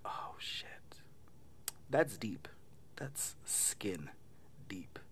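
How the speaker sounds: hushed, breathy-free murmur under the breath; whispered aside. Retold or imitated. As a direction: drop to a sly whisper for the punchline. Narrator's own reaction, whispered quip.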